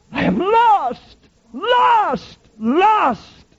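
high, slow, despairing wail of the doomed; anguished cry. Retold or imitated. Dying infidel crying out his doom, high anguished wail. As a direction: cry it out like a man seeing hell open. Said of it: "cried the infidel Adams when dying".

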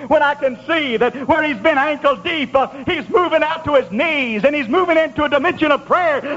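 high, driving revival sermon; fervent preaching. Real, not acted. Preacher rejoicing over a man who starts to pray, fervent. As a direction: preach it hard, punctuated with fervent praise.

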